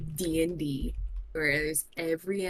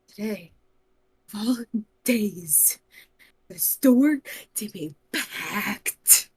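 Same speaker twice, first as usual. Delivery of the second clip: halting disbelief, building louder; utter disbelief; 2 semitones higher, 7 dB louder than usual. Acted. Hangry adventurer finding the store packed, exasperated disbelief. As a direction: break it into stunned pieces, stretch the end. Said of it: "she is in utter disbelief".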